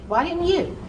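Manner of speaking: usual speaking voice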